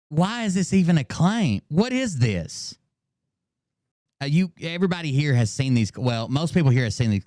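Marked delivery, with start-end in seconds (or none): 0.1-2.8 baffled protest, pitch lifted high then slowing; can't believe the accusation; high-pitched disbelief, dragged out at the end; incredulous. Real, not acted.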